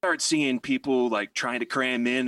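ordinary delivery

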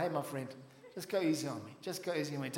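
soft, coaxing plea; quiet pleading. Pretend.

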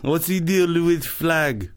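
put-on high, slow Russian accent drifting French; botched Russian comic impression, sounding French; off-target Russian accent. Comic riffing as foreign stand-up, botched Russian accent. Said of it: "That was like a French".

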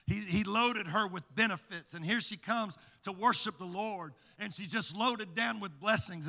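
ordinary delivery

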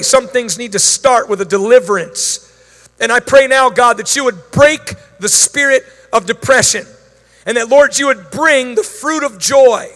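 loud, fervent preaching prayer, building in power; full-force preaching. Acted. Pastor praying over the congregation in loud preaching voice. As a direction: command it from the pulpit with authority.

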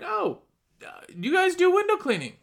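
high-pitched sudden realization; surprised customer, voice lifted way up; intrigued customer. Retold or imitated. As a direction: play a passer-by who just spotted it. Acted customer noticing the sign.

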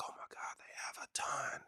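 hushed, breathless whisper of amazement; awed whisper. Retold or imitated. Answering God about Hillsong's songs, in an awed whisper. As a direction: whisper it like you're overwhelmed.

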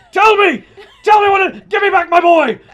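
hammed-up screaming, very high and loud; over-the-top hysterical pleading; frantic demand. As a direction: yell it in mock desperation. Acted begging clinic for test result.

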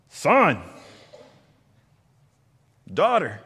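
loud, high call across a crowd; parent calling out. Retold or imitated. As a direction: call your child home. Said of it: "and you hear that voice".

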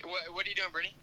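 from another room. Real, not acted. Roommate calling from another room.

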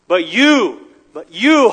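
YOU punched loud, rising on the repeat; emphatic YOU. Acted. David turns emphatically to God.

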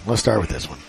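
quiet throwaway repeat; low muttered repeat. Acted. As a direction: drop it low and soft, almost under breath.

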